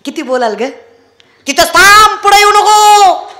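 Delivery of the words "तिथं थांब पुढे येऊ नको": higher, louder, slow stern order; stern wife. Acted. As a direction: hold him back at the door. Wife stops husband at door. (रागाने थांबवणारी बायको)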